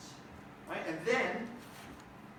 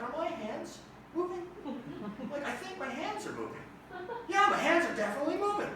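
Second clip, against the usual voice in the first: much higher, growing louder in wonder; rising from awed hush to giddy wonder; amazed tripping voice; 11 semitones higher, 4 dB louder than usual. Retold or imitated. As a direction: marvel like you're high and discovering it. Tripping, amazed at moving hands.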